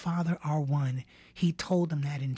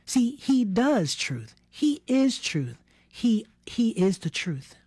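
slow emphatic preaching; 4 semitones higher, slower than usual. Real, not acted.

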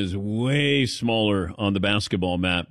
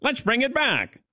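high, put-on old-time announcer; radio broadcaster voice; 10 semitones higher than usual. Host jokingly reviving the old style, radio broadcaster voice. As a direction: keep up the mock vintage radio voice. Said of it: "supposed to be the radio broadcaster voice".

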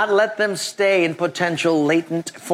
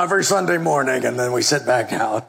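ordinary talk, nothing marked

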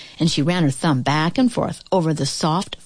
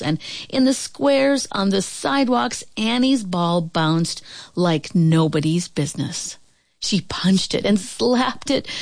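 ordinary delivery

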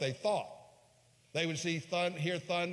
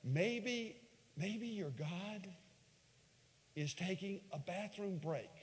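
mocking taunt; 5 semitones higher, 6 dB quieter than usual. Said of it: "He, he even says".

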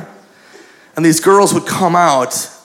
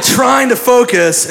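ordinary talk

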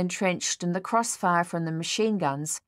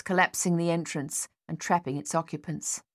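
usual speaking voice

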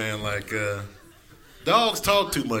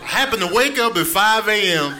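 yelling hungry cat; 5 semitones higher than usual. Retold or imitated. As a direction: scold the owner as a hungry cat. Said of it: "cats are yelling at you".

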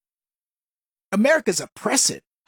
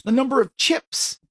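normal talking voice

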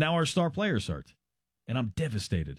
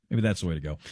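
ordinary talk, nothing marked

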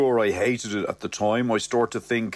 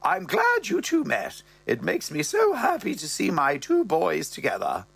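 mother's voice; 9 semitones higher than usual. Acted. Mother pleased to see her sons, higher voice. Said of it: "the old dear goes".

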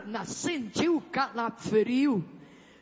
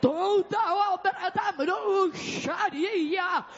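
fervent deliverance preaching; 6 semitones higher than usual. Real, not acted. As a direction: drive out evil like a revival preacher. Preaches and rebukes evil at full force. (pregação de libertação, ordens bradadas com fervor)

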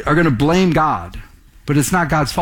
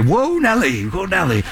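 calling a horse; 2 semitones higher than usual. Retold or imitated. Guest speaker calls to his horse Nellie, mock calling out. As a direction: jokingly urge on an imagined horse.

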